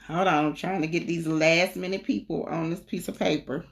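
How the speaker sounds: usual speaking voice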